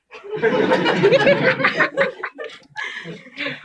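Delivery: long burst of laughter; loud laugh. Real, not acted. Laughs at own joke.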